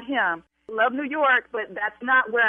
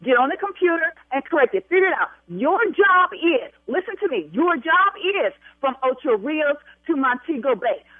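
angry shouting; 3 semitones higher than usual. Acted. Bride ordering the agent to fix the booking, angry. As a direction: bark each order at him.

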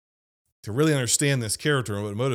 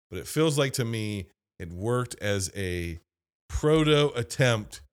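ordinary talk, nothing marked

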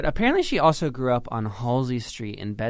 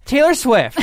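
high, loud excited squeal; 13 semitones higher, 12 dB louder than usual. Real, not acted. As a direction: squeal the name with glee. Big reveal of the queen.

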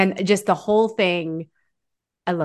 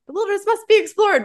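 high, bright recital of a motto; chirpy Russell catchphrase; 13 semitones higher, faster than usual. Retold or imitated. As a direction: recite it like an eager scout. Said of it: "Up catchphrase quoted".

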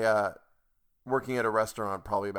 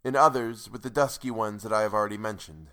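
normal talking voice

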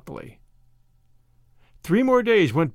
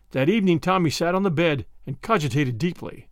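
plain reading voice

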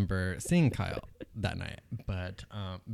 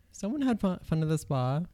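lightly voiced note; 7 semitones higher than usual. Reading out the note.

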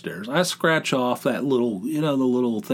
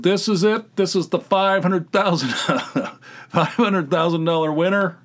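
higher-pitched, excited self-quote with a laugh breaking in; triumphant announcement; 6 semitones higher, 3 dB louder than usual. Retold or imitated. Man announcing his lottery ticket to the house, triumphant. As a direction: announce the big win like it's certain. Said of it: "I make the announcement".

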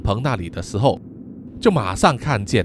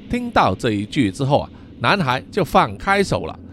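ordinary delivery, nothing marked

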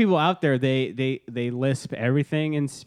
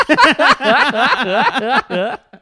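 high, loud burst of laughter; cracking up; 11 semitones higher, 9 dB louder than usual. Real, not acted. Laughs at own joke.

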